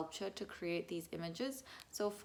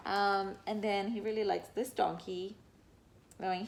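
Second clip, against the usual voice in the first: ordinary delivery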